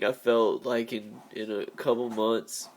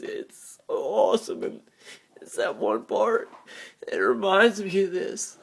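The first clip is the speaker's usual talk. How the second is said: choking out the words while weeping; crying; 8 semitones higher, 4 dB louder than usual. Real, not acted. Telling viewers about a movie scene, crying. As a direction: voice pitched up high and breaking through tears. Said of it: "I'm sorry about the crying".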